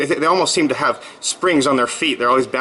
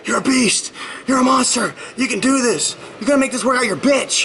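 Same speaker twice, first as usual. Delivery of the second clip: re-enacted gym pep-talk scream, pitched way up; imitated motivational yelling; 12 semitones higher than usual. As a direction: mock-scream like you're pumping yourself through a workout. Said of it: "I start yelling and screaming".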